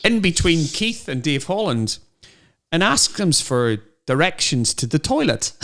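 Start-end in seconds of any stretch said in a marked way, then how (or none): none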